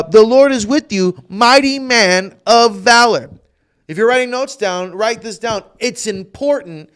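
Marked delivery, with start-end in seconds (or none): 0.0-3.3 loud proclamation. Acted. Angel's words retold, fast lead-in.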